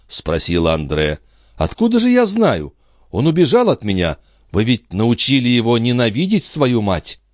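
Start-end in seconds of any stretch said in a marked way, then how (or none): 1.6-7.2 high-pitched, agitated woman throwing back blame; distraught lady. Acted. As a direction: answer in high, wounded indignation. Андре оправдывается и обвиняет. (возмущённая мать, высоким взволнованным голосом)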